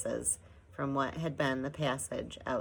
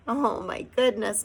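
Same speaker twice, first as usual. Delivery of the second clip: moaning; 7 semitones higher, 5 dB louder than usual. A narrator's reading. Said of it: "moaned Homily".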